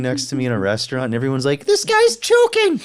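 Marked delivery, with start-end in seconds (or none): 1.6-2.8 much higher, slow alarmed cry; hammed-up high-pitched alarm call; mock panicked cry. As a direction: raise the alarm in comic panic. Acting out panicked restaurant bystanders.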